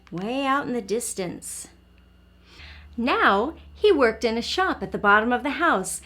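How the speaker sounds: put-on soft storybook voice for a young listener; reading to children. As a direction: read it gently, like at bedtime. Reads picture book aloud.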